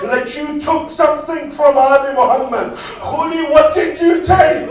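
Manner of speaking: loud, high wailing sermon cry; preaching at full force. Acted. Majlis recitation at full force.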